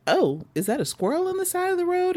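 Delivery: quick, high-pitched wondering aloud; bright startled question; high excited musing. Retold or imitated. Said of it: "I was like".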